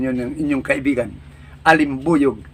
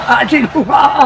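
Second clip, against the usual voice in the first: usual speaking voice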